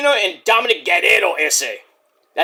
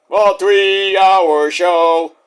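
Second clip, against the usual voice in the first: very slow, stretched-out monotone; exaggerated long-winded repeat; mock drawl; 3 dB louder, much slower than usual. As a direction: drag out every word of "three-hour show". Drawn-out mocking repeat.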